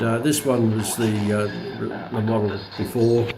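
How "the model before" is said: usual speaking voice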